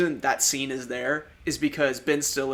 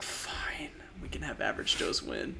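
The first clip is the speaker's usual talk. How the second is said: whisper; 10 dB quieter than usual. Retold or imitated. Reluctant studio quote, whispered.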